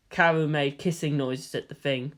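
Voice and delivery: usual speaking voice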